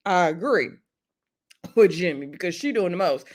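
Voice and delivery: ordinary talk, nothing marked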